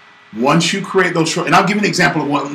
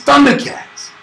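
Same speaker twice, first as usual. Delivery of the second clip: Thundercats; 11 semitones higher than usual. Retold or imitated. Callback to battle cry.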